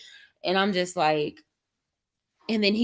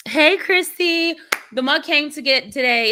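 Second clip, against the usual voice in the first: raised singsong holler; calling out; 8 semitones higher, 6 dB louder than usual. Real, not acted. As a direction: call across the house to a friend. Calling out to someone in house.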